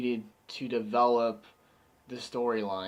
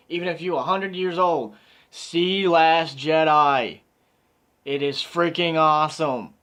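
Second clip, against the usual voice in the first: hyped plea; 2 semitones higher, 6 dB louder than usual. Real, not acted. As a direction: shout the recommendation to everyone. Hyped closing recommendation.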